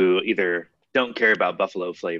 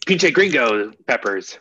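loud joking; 3 semitones higher, 8 dB louder than usual. Acted. Joking exclamation about hot peppers.

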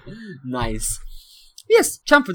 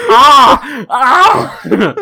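mock wail; 10 semitones higher, 10 dB louder than usual. Mock crying while reading reply.

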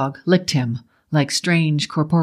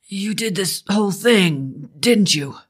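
raised, slow accusation with desperation; slightly higher, drawn-out plea; desperate accusation; 4 semitones higher than usual. A narrator's reading. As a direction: accuse with more desperation than anger. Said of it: "There wasn't so much anger as desperation".

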